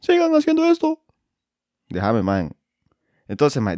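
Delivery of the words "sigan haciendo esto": very high squeaky fan voice; mock fan voice. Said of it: "¿Qué puta dijiste?".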